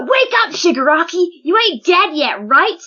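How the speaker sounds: high, urgent calling out; acted yell. Spinner trying to wake Shigaraki, acted yell. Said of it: "he yells out".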